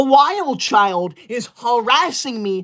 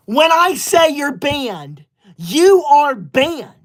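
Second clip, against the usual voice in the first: slow, higher, louder, every word laid down; stern mock decree; 5 semitones higher, 4 dB louder, much slower than usual. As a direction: lay down the law like a playacting teacher. Stern mock teacher banning viewers.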